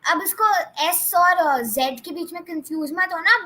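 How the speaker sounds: usual speaking voice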